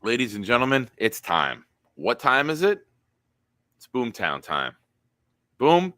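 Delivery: hammed-up announcer build to Boom; announcer intro. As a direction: announce the segment like a ring MC. Announces Boomtown segment.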